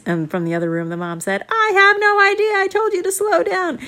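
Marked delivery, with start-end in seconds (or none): 1.5-3.8 scolding mom. Acted. Mom scolding from other room.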